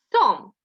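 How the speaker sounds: teacher blends sounds into a syllable; blending demo. Shown as a demonstration. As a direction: join the sounds into Сам firmly. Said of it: "Називайте звук".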